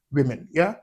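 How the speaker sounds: ordinary talk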